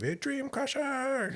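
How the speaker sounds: high, drawn-out far-off call; mock heckling. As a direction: call it out like teenagers jeering at a killjoy. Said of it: "shaking their fist at you".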